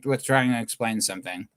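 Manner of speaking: ordinary talk, nothing marked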